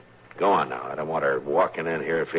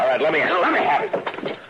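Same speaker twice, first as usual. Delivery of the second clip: urgent shout; 12 semitones higher, 6 dB louder than usual. Acted. Grabbing the gun from her.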